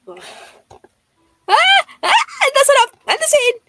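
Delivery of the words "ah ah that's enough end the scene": high and loud flustered yelling; embarrassed shriek. Real, not acted. Streamer flustered by the memoir text, shrieking. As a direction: cry out to make it stop.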